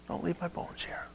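unvoiced hushed Don't leave my bones here; whispered last request. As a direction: breathe the dying wish.

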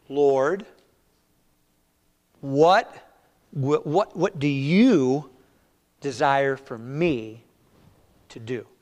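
very slow, halting, long pauses; slow paraphrase. Shown as a demonstration. Preacher rephrasing the prayer to the room, very slow. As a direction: let each word sink in. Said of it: "Let's read it a little slower".

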